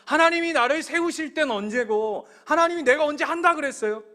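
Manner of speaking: loud, high outburst at God; acted furious protest. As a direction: shout it as Saul railing at God. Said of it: "그 사울이 벽을 치면서".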